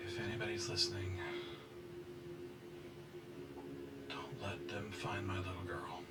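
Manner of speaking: soft, near-whispered prayer; hushed plea. Acted. Mother prays alone for her little girl, hushed plea. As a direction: plead under your breath to whoever may listen.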